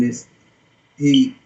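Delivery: ordinary talk, nothing marked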